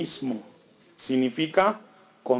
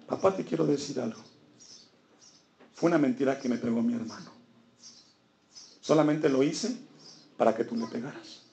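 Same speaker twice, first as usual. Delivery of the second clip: put-on soft, lowered voice of a child owning up; acted guilty little confession, hushed; remorseful confession; 3 semitones lower, 5 dB quieter, faster than usual. Remorseful girl confessing to her father. (confesión arrepentida en voz baja)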